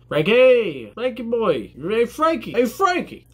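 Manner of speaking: high, drawn-out hollering of a nickname; mock rowdy greeting, pitched high; playful calling out. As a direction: call out like hailing an old pal.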